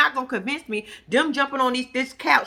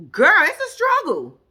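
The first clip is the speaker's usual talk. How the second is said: dramatic; 8 semitones higher, 6 dB louder, slower than usual. Real, not acted. Dramatizing getting off mattress.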